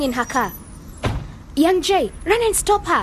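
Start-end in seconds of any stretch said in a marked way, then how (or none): 1.6-3.0 high, pressing order; urgent call. Acted. Telling young Jay to catch the lady, urgent. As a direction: send him running now. Urging friend to chase lady.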